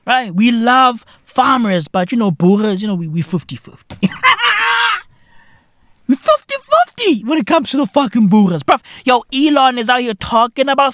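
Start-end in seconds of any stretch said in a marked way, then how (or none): 4.1-5.0 high shrieking laugh. Real, not acted. Vlogger laughing at his own joke, high shriek. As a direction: crack up in a high cackle.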